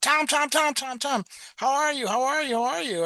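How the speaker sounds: high, rapid-fire repeated hellos; mock excited greeting. Ophthalmologist greeting his patient on the street, high and excited. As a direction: imitate an over-eager acquaintance calling out, pitched well up. Doctor jumps out to greet him.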